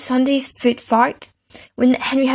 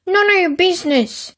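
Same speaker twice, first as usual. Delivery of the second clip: put-on high snippy boy; 9 semitones higher than usual. A narrator's reading. Peter snaps back.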